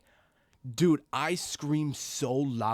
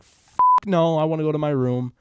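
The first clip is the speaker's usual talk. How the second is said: emphatic, loud mock protest; loud retold refusal; 3 semitones higher, 9 dB louder than usual. Frightened kid refusing the hallway next morning, loud mock protest. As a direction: act out a kid's adamant refusal, loudly. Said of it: "I was like".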